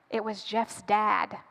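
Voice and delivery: low, slow and soft; hushed reveal. Acted. Storyteller reveals who is at the door, hushed. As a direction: let it drop, quiet and slow, with dread.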